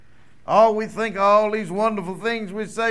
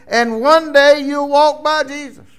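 raised, ringing proclamation; preaching climax; 6 semitones higher, 9 dB louder than usual. Real, not acted. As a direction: lift it up to the congregation.

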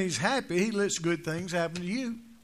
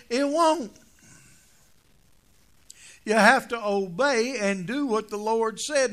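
slow, louder preaching at full force; 3 semitones higher, 6 dB louder than usual. Acted. Preacher driving home trust and obey, full force. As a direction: pound out the point to the congregation.

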